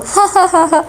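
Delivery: teasing laugh. Acted. Hoor laughing at her two friends, light teasing. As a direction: laugh at them triumphantly.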